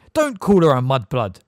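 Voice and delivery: loud angry rebuke; very angrily. A narrator's reading. Siblings defending their friend against the elf, angry shout. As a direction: snap it back in outrage. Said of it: "very angrily".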